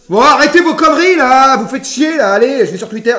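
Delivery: exasperated burst, easing off; fed-up scolding. Real, not acted. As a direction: scold the chat at full voice. Scolds chat for their nonsense.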